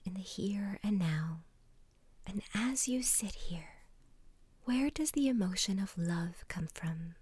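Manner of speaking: guided meditation whisper. Acted. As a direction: whisper them gently into calm.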